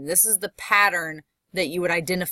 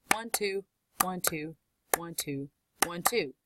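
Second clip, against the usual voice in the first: soft "one, two" pairs with weight on each "two"; iambic count; 9 dB quieter than usual. Shown as a demonstration. Teacher counts out the beat for class, soft iambic "one, two" with weight on "two". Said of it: "the second word is the one that carries weight to it".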